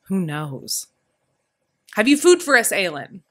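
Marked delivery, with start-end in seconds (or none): 1.9-3.2 high, loud bellow across the yard; hearty shout to be heard by everyone; booming call. Acted. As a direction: boom it out to the whole courtyard. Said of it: "Father boomed over the courtyard".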